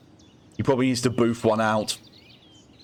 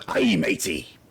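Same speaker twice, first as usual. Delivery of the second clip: pirate voice; 8 semitones lower, slower than usual. Retold or imitated. Pirate punchline of joke.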